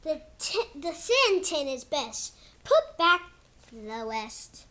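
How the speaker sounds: slow, sing-song storybook read; reading to kids. Acted. Kid reading his book to viewers in storybook voice. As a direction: read it like a bedtime book for little ones. Reads picture book aloud.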